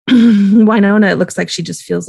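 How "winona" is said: ordinary delivery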